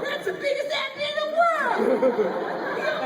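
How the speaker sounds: high, giddy mock fan voice; high-pitched starstruck fan impression; mimicking a fan. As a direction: squeal it like a starstruck lady. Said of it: "She's like".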